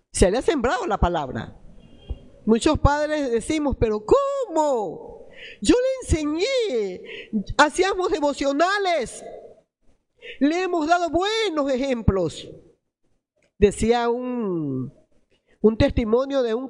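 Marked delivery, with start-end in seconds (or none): 3.8-12.5 parent's bewildered protest, pitched higher; mock dismay. Padres incrédulos ante el hijo. (padre desconcertado, tono más agudo)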